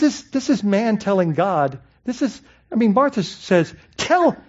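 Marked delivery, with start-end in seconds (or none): 4.0-4.4 a sharp, demanding "Tell". Retold or imitated. Martha ordering Jesus, demanding "Tell". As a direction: bark the word "Tell" high like an order. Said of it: "making demands".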